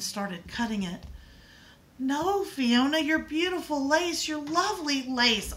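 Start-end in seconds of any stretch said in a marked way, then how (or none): 2.0-5.5 hysterical scream. A narrator's reading. Little sister wails at Fiona cutting her lace, higher and louder. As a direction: cry out in panic over the ruined lace. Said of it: "Eilish screamed as she became hysterical".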